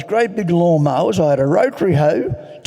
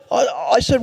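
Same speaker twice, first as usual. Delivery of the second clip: normal talking voice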